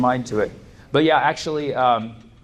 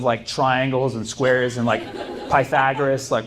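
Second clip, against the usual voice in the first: ordinary talk, nothing marked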